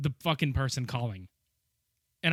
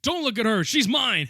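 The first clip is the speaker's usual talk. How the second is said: louder, higher mock jealous claim; possessive criminal; 6 semitones higher, 5 dB louder than usual. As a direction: claim her like a jealous madman. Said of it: "the criminal was like".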